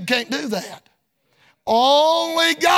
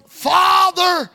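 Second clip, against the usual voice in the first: crying out; 6 semitones higher, 4 dB louder than usual. Retold or imitated. Preacher voicing Jesus' cry.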